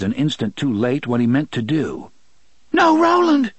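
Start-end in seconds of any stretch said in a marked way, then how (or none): 2.7-3.5 loud, very high-pitched cry of alarm; horrified cry. A narrator's reading. Susanna tries to stop Roland throwing the stone, horrified cry. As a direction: cry out in horror, pitch leaping up. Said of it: "her own horrified voice".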